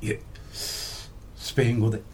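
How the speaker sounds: quiet, slow, near-whispered answer; soft murmured reply. Retold or imitated. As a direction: answer humbly under the breath. Interviewee's reply in retold exchange. (小声でぼそっと答える)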